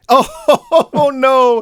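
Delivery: high laughing oh no. Real, not acted. Laughing dismay.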